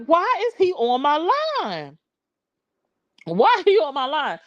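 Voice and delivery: high-pitched incredulous question, dropping on "line"; retold indignant bafflement; exasperated disbelief. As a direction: demand to know why he's calling. Retold disbelief at his reaching out.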